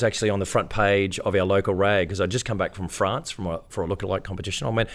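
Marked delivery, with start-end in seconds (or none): none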